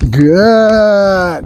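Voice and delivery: long, stretched-out praise word; duration marker. Real, not acted. Trainer telling dog to keep waiting, drawn-out marker. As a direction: hold the word out slowly, letting it fall. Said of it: "The good duration marker".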